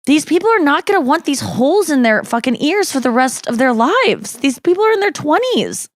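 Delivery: climbing pitch, mocking disbelief at gauged ears; incredulous rant. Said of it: "And I go".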